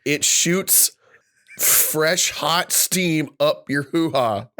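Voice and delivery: deliberate deadpan with pauses; measured, mock-serious announcement; straight face. Shown as a demonstration. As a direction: hold back the laugh and state it slowly. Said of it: "Can you say that with a straight face".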